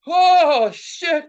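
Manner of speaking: loud, high "oh" of dawning trouble; alarmed cry. Retold or imitated. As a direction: relive the burn hitting you. Reenacts feeling the burning fumes.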